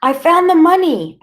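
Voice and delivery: high, loud scream of confession; boy shouting at the policemen, high and loud; desperate shout. Acted. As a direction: shout it out in panic. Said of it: "I shouted".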